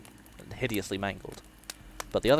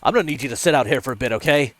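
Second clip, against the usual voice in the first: quick, loud, raised instruction; officer calling out; 4 semitones higher, 10 dB louder, faster than usual. A narrator's reading. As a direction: give brisk orders over a storm. Officer calling over the storm.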